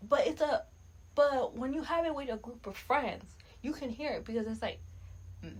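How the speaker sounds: ordinary talk, nothing marked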